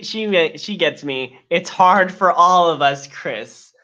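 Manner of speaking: ordinary talk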